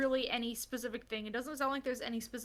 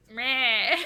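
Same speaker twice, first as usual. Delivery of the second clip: cat imitation; 11 dB louder than usual. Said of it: "My cats are like".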